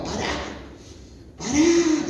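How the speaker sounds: imitated yelling. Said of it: "a los gritos". (grito agudo imitado, alargando la palabra)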